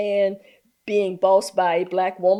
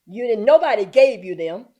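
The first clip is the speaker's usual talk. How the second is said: ordinary talk, nothing marked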